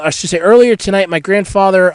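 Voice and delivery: ordinary talk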